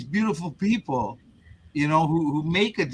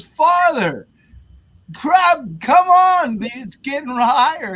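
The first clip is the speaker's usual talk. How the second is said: shouting Coast Guard; 11 semitones higher, 7 dB louder, slower than usual. Retold or imitated. Coast Guard yells at priest.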